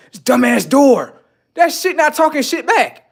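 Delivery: high, loud mock fury at a door; mock angry rant. Mocking himself yelling at a locked door, high and loud.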